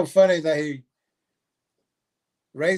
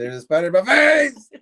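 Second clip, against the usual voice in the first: mock yelp; 5 semitones higher, 6 dB louder than usual. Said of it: "He said".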